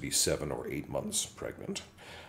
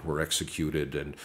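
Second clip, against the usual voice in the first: ordinary talk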